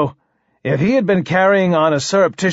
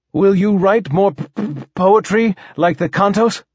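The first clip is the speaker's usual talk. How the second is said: higher voice, stuck on the P; stuttering king; 6 semitones higher than usual. Acted. King asks poet for more poetry, stammering. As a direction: ask hopefully with a stammer.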